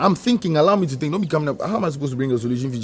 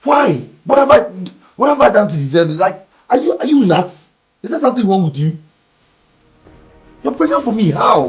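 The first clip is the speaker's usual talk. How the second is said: loud, high outraged questions; angry disbelief; 6 semitones higher, 8 dB louder than usual. Acted. Man yelling at Bella about the pregnancy, outraged. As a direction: shout like it makes no sense.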